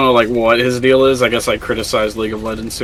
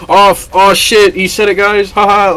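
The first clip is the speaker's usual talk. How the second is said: higher, louder fake cheering at a reveal; mock excitement; 8 semitones higher, 5 dB louder than usual. Teasing a muted friend with a fake reveal, mock excited. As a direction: imitate a crowd whooping in fake shock. Mocking fake reaction to his silence.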